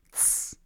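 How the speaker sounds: soft, drawn shushing; a hushed "shh" for silence; hushing hiss. A narrator's reading. Bi silences the others, hushing hiss. Shushing, finger to lips follows.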